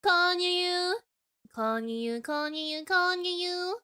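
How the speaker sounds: high, squeaky-cute streamer greeting; put-on high greeting chant. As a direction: chirp it in a high idol voice. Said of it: "isn't it crazy how that isn't my real voice".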